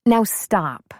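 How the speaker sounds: firm. A narrator's reading. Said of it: "she said firmly".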